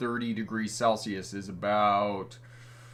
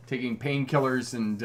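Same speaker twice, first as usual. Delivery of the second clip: ordinary talk, nothing marked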